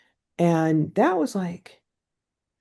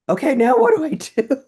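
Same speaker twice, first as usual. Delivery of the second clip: higher, louder flustered question; flustered bewilderment; 8 semitones higher, 5 dB louder than usual. Retold or imitated. Told she may take communion, she asks louder and higher, flustered. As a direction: throw up your hands, half laughing, lost. Flustered after priest's permission.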